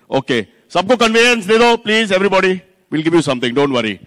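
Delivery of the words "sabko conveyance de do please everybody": calling out. Real, not acted. Host calling out to the waiting crowd.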